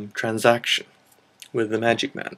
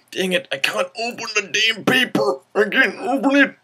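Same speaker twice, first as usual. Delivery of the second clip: frustrated; 10 semitones higher than usual. Real, not acted. Struggling to open paper slip.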